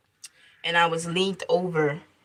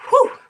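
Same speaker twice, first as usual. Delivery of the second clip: strained push exhale; 7 dB louder than usual. Retold or imitated. Pushing during labor.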